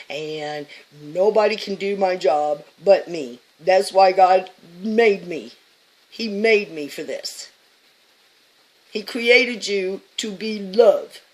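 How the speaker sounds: loud, slow, booming declarations; forceful slow sermon-style shout; preaching shout. Real, not acted. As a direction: proclaim it to the crowd, slowly. Preaching tirade about her mission.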